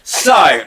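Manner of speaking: bright burst of laughter; real laugh. Real, not acted. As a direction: laugh out loud. Host laughs at improv.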